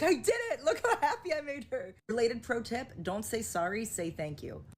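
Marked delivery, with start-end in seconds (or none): 0.0-1.9 high, thrilled imitation of a proud husband; beaming pride. Husband proud he made his wife happy, beaming pride. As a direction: voice his triumph, pitched up and delighted. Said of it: "He's just, like, beaming".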